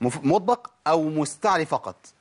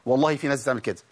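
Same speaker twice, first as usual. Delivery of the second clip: ordinary talk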